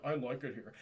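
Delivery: low, soft mock droop; droopy-faced mumbler. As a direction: let it sag like a slack face. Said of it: "face gets a little droopy".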